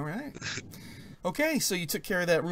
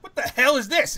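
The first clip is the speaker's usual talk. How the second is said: puzzled outburst; 8 semitones higher, 8 dB louder than usual. Acted. GM voices NPC confused.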